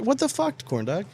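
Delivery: ordinary talk, nothing marked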